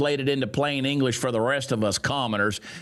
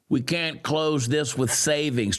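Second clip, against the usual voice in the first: ordinary talk, nothing marked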